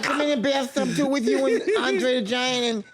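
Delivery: eager boxer inviting himself along; Mike Tyson. Acted. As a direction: play a famous boxer saying hello. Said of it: "Mike Tyson just walked in".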